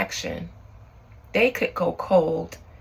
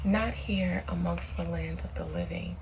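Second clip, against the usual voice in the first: summoning a spirit; 5 dB quieter than usual. Acted.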